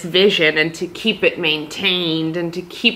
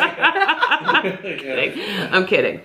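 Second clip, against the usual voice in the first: real laughter through the words; laughing; much slower than usual. Real, not acted. Shop owner taking back her joke about closing, laughing. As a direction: laugh it out, drawn long.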